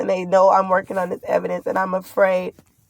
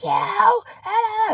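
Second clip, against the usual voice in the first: much higher, mocking yelled jeer; mock shriek; 14 semitones higher than usual. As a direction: screech it like a taunting neighbour. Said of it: "Bianca was like".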